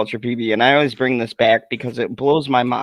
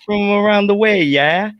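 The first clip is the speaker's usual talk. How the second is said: high-pitched New York guy impression; New York accent; 8 semitones higher than usual. Friend mimicking streamers shooting the breeze, New York accent. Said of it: "got the New York accent".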